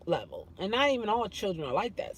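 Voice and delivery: ordinary talk, nothing marked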